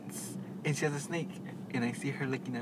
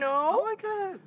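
amused squeal; slower than usual. Real, not acted. Mocking friend's impression.

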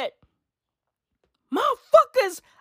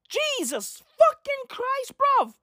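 exasperated; 4 dB quieter, slower than usual. Real, not acted. Exasperated outburst.

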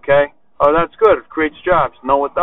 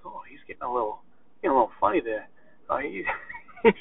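very soft, hesitant aside as an uneasy listener; mock concerned murmur; 12 dB quieter, faster than usual. Imitating lighthearted listeners reacting to his bragging, in a hushed mock-worried voice. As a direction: drop the volume right down, imitating someone tiptoeing round it.

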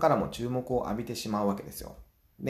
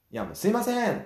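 higher, louder, fed-up call; louder irritated call; 9 semitones higher than usual. Retold or imitated. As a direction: call out again, patience running thin. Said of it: "もうちょっと大きな声で". (苛立ちまじりに声を張って店員を呼ぶ)

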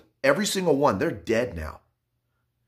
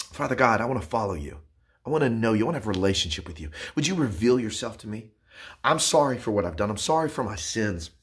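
guided prayer; 4 semitones lower than usual. Acted. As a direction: lead the listener through a sinner's prayer, gently. Leads a prayer of salvation.

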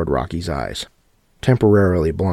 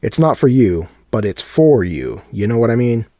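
louder, higher teasing host; teasing showman; 5 semitones higher, 6 dB louder than usual. A narrator's reading. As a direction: tease the crowd knowingly. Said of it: "Sebastian teasing the crowd".

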